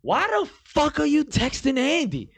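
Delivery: very high, hammed-up indignation; squawked, incredulous angry question; escalating outrage. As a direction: reenact drunken fury with the voice way up. Retold angry phone quarrel escalates.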